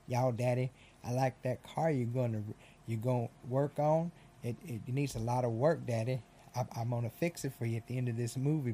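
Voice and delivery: mock the kid's thick Southern accent; heavy Southern accent. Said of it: "with a heavy fucking accent".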